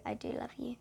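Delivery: soft unvoiced whisper; imagined mother's loving whisper. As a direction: breathe the words tenderly.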